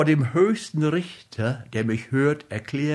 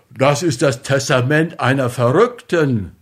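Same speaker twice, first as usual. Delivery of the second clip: louder, sharp outraged cry; outraged shout; 6 dB louder than usual. A narrator's reading. Husband leaping up at the testament, shouting. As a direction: hurl it out in disbelief. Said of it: "war aufgesprungen und rief".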